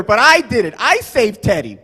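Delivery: normal talking voice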